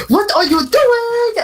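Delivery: very high, slow shocked mother's shriek; shrieking mom. Retold or imitated. Mom catches son, shrieking.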